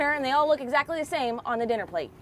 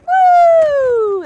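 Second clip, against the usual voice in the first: excited cheer; 14 dB louder than usual. Real, not acted. Catching a fish, cheering.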